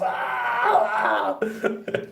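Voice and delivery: high, wheezing laugh fit; helpless laughter. Real, not acted. Laughing hard at the memory of hosing him off. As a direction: crack up completely.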